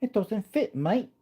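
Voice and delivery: high laughing mock British "mate". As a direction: repeat it as a cheeky Brit. Repeats line in mock British.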